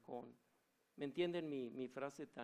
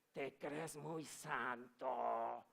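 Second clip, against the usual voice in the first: very slow, strange demonic taunt; much slower than usual. Retold or imitated. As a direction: sneer it slowly like a demon.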